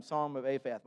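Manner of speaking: usual speaking voice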